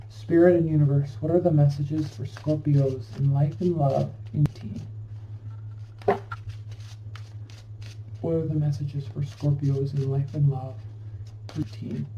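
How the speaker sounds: soft, even-pitched request to spirit; prayerful invocation. Real, not acted. Invokes spirit for the reading.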